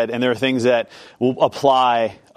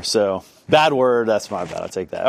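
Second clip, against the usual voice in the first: usual speaking voice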